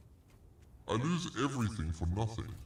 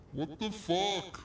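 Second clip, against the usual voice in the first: usual speaking voice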